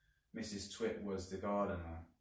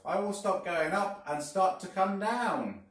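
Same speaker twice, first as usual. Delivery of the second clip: high, loud cackling witch voice; a sly old hag plotting out loud; Mrs Twit; 10 semitones higher, 8 dB louder than usual. A narrator's reading. Mrs Twit speaks aloud, quote.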